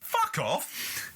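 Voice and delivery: high and slow, outraged; indignant rant. Real, not acted. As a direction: spit out the scorn. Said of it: "I'm gonna get on my soapbox".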